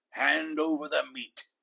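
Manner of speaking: a hint of a greedy pirate captain; pirate demand. A narrator's reading. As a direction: bark for the meat, lightly voiced.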